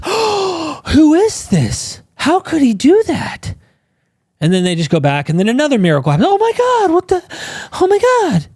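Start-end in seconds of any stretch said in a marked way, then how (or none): 0.0-3.5 high, hammed-up gasping wonder; mock amazement. Mocking the disciples' amazement at each miracle, very high. 6.3-8.5 very high, hammed-up gasp of awe; mock astonishment. Mocking the clueless disciples seeing another miracle, very high.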